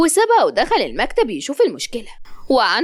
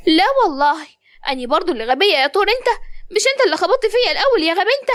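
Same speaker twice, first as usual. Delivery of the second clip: put-on indignant girl; 7 semitones higher, faster than usual. Malak fires back at Zein, high, fast and indignant. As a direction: rattle it off at him, offended.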